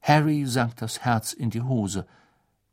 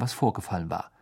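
usual speaking voice

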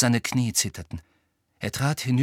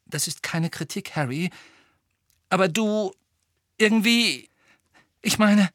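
halting, higher, careful phrasing; hesitant; 5 semitones higher than usual. A narrator's reading. Hermine cautiously criticises Harry, haltingly. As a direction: feel your way with long pauses.

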